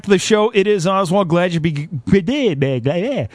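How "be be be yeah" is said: ordinary talk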